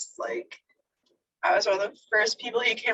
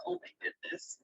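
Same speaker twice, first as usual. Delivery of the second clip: breathless, choked-up whisper; voix qui se noue, au bord des larmes; tearing up; 8 semitones higher, 13 dB quieter than usual. Real, not acted. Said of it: "I'm gonna tear up just thinking about it".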